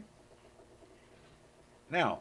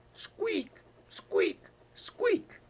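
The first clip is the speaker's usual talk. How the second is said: tiny squeaks pitched far higher; mouse squeak; 4 dB louder, much slower than usual. Acted. Grandpa voicing the timid mouse for his granddaughter, high squeaks. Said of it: "and he said".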